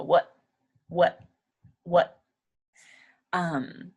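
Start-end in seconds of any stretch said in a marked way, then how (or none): 0.0-2.2 "What?" ending with tongue behind teeth, no burst; unreleased T. Shown as a demonstration. Teacher drilling the native "What?". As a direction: hold the T silent at the end of "What?". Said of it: "they kinda just put their tongue behind their teeth".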